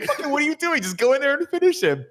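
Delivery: very high, frantic retold order; exasperated mock yell. Backer orders his fighter to finish him, high mock outrage. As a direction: shriek the order in comic exasperation.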